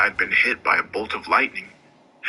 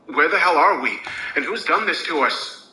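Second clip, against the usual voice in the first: angry demand; 7 semitones higher than usual. A narrator's reading. Narrator demands answers from Gideon, enraged. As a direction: shout the demand in rage. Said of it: "my fear began to turn into rage".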